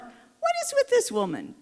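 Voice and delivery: very high, exasperated disbelief; incredulous thought. Retold or imitated. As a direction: squeak it out in baffled disbelief. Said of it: "And I thought".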